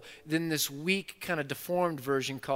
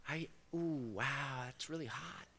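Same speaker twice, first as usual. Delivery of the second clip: mock overheated; 10 dB quieter, much slower than usual. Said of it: "And then God's like".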